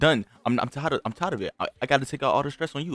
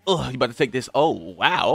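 ordinary delivery

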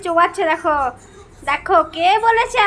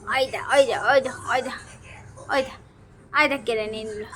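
hushed aside; 7 semitones lower, 8 dB quieter, faster than usual. Real, not acted. Hushed aside while pet eats.

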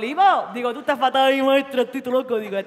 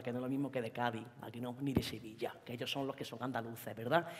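hushed mumbling Jaén lad; 10 semitones lower, 14 dB quieter than usual. Acted. Long hushed Jaén lad monologue.

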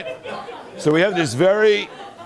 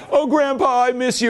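high, sweet kid voice, imitating; teary grandchild voice; 10 semitones higher than usual. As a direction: play the kid missing Grandpa. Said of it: "those stupid A T and T commercials with Grandpa".

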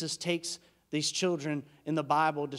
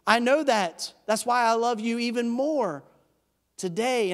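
loud, higher-pitched argument back; heated insistence; 6 semitones higher, 4 dB louder than usual. Acted. Jesus answering the one who rejects his love, heated insistence. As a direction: insist on it forcefully, voice raised. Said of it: "that angry conversation going".

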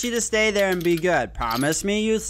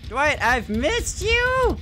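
slow, high, menacing sing-song; creepy taunting; 8 semitones higher, much slower than usual. Pretend. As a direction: tease him slowly like a stalker. Taunting the survivor.

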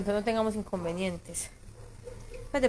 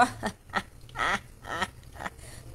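breathy villain cackle at the viewers; evil laugh; 7 dB quieter than usual. Pretend. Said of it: "Esa es mi risa malvada".

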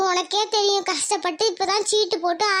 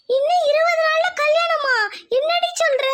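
shocked disbelief; 7 semitones higher than usual. Acted. Friend learns wedding is in twenty days, aghast. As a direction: repeat the news as a stunned question.

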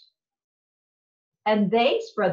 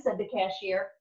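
plain reading voice

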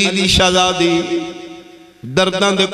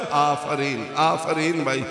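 soft praise; 2 semitones lower, 9 dB quieter than usual. Acted. Soft aside praising again.

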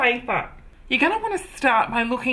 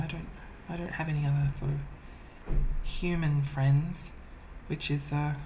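quiet sad admission; 6 semitones lower, 8 dB quieter than usual. Real, not acted. Admits having no friends.

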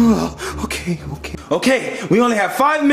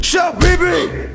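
angry yell; 7 semitones higher than usual. Acted. Yelling at Toad.

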